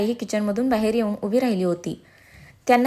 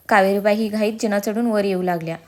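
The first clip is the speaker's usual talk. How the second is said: ordinary delivery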